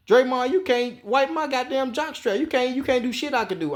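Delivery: mock angry star player, high and cocky; pretend locker-room rant. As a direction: play Durant putting Draymond in his place. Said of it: "approached them in their locker room and say".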